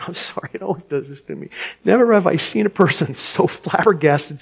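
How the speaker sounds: plain reading voice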